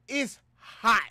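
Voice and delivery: loud, high cry of it's hot; hammed-up heat complaint. Streamer joking to viewers about the heat, loud mock wail. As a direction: wail it like the heat is killing you.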